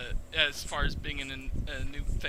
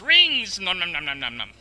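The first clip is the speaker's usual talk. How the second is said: squeaky nibbling hamster; 9 dB louder than usual. Retold or imitated. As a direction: shout Rings, then munch nom nom. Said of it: "and he goes".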